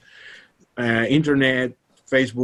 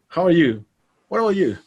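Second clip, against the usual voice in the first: questioning back; 7 semitones higher, 4 dB louder, faster than usual. Retold or imitated. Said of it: "answer the question with a question".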